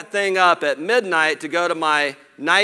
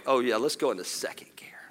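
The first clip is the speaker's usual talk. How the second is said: breathy whispered daydream of a cruise; playful whisper imagining the beach drive; mock whisper; 4 semitones lower, 6 dB quieter than usual. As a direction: whisper it like a cool secret.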